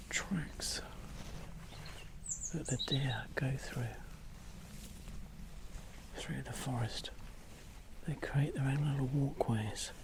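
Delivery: soft, near-whispered commentary; hushed. Real, not acted. Photographer showing viewers the tracks deer go through, hushed. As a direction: murmur it with long pauses.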